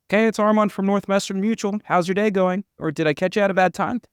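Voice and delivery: high, bright-pitched canned sales greeting; chirpy customer-service cold call; generic telemarketer opener. Retold or imitated. Young rep cold-calling a prospect, overly chipper. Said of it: "They could smell it in my tone".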